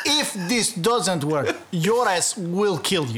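slow laughing mock Russian; drawn-out Russian doctor, laughing; Russian accent.

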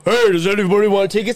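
lightly put-on boss voice; mild mock calling-out; funny boss voice. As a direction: mimic the co-host's goofy boss. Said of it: "when you go".

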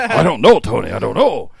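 overacted pleading; hammy stage actor. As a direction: ham up the denial. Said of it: "that overacting that dude had".

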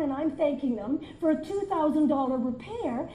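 usual speaking voice

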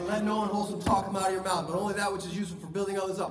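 fast, rattled off from memory like a bored recitation. Retold or imitated. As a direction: reel the verse off quickly, tired of hearing it. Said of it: "I had the passage memorized".

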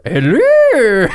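high, loud cartoon monster greeting; Grimace voice. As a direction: squeal it like a goofy creature. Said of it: "and he goes".

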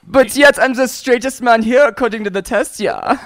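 put-on German-accented boast with a tag "ja"; playful German self-defence; mock German accent. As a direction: protest proudly in a comic German accent. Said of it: "out of all the accents he can do".

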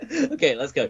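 ordinary delivery

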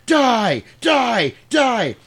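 high, loud repeated shout; mock furious yell. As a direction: yell each die like you're stabbing. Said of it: "he's like".

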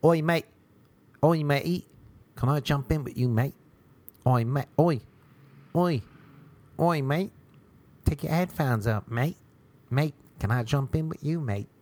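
hammed-up English "oi, mate"; English accent. English guy calling to a man in headphones, mock English accent. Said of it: "My English accent is down to a T".

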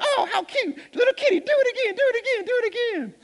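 very high, fast hyena voice with laughter through it; laughing hyenas. Acted. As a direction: cackle the taunt out in a rush. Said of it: "they're laughing like".

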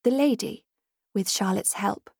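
plain reading voice

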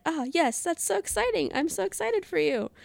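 very high, gushing mock enthusiasm; high-pitched retold gush; gushing excitement. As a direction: squeal it sweetly, playing up the excitement. Reads out her own gushing reply.

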